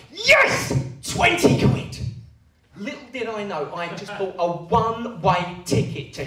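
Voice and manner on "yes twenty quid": high, loud cheer of victory; triumphant yell. Retold or imitated. Winning a bet with dad and brother, cheering. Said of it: "I go".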